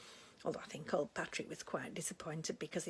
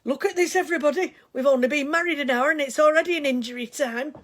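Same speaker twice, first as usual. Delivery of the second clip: joking announcement; 7 semitones higher, 17 dB louder, faster than usual. Retold or imitated. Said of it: "And he said".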